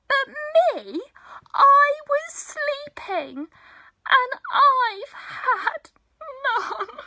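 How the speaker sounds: high, loud mock crying; whimpering, wailing Bear. A narrator's reading. Bear complains he slept through the fun, mock wailing. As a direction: wail like a hard-done-by bear. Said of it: "And he whimpers".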